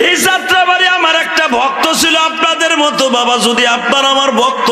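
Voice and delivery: preaching shout. Real, not acted. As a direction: lift the crowd with it. Preacher peaking. (উঁচু চড়া গলায় ওয়াজ)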